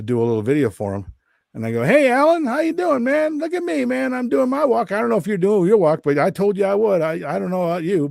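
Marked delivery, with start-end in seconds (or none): 1.8-8.1 high-pitched teasing singsong; mocking. Walker taunting his friend in a video message, high-pitched mocking. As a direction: crow at a friend in a high, gloating voice. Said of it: "I'm laughing and, and making fun of him".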